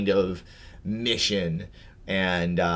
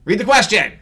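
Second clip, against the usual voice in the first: much higher, loud barked order; loud demand; 11 semitones higher, 10 dB louder than usual. Real, not acted. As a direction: yell it impatiently. Impatient push to read.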